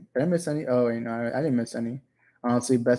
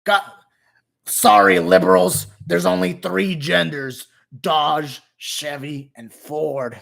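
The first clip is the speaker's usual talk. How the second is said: hammed-up American patriot; 2 semitones higher, 6 dB louder, slower than usual. Streamer parodying a patriot telling off liberals, strained hammed-up voice. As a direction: push a rough patriot voice until it hurts. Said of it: "Fuck, that hurts my voice".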